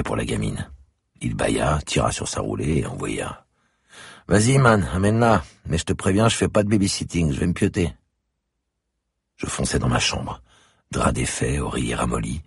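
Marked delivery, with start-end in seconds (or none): 4.3-7.9 put-on high, loud, casual young neighbour; a lazy guy who can't be bothered; slacker neighbour. A narrator's reading. Sleepy neighbour Willy agrees to watch the girl, loud and casual.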